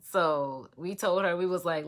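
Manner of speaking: normal talking voice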